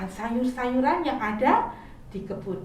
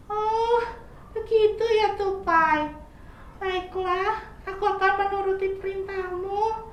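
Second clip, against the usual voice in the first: sick caterpillar; 8 semitones higher than usual. Acted. Caterpillar telling squirrel his stomach hurts, high whiny voice. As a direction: answer like a sorry sick child.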